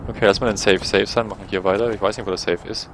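ordinary talk, nothing marked

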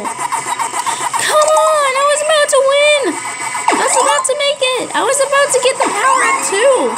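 high, fast complaint; real gamer frustration; frustrated rant. Real, not acted. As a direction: rush out the protest. Frustrated ranting after loss.

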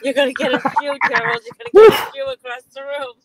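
loud laughing threat; scolding mother. Retold or imitated. Woman teasing Gerald about a flying shoe, mock scolding. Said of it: "That's what she used to say".

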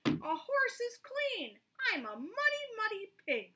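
pig voice. A narrator's reading. Pig character speaks.

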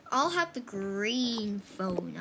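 ordinary delivery

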